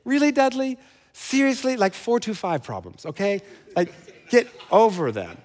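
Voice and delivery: a lightly voiced Jesus calling his bluff; exasperated Jesus. Jesus challenging the whiny pastor, mildly incredulous. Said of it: "I need Jesus to look at me and challenge me and say".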